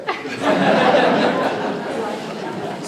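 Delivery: big laugh after the punchline; hearty laugh. Real, not acted. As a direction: let the laugh roll out. Audience laughs at joke.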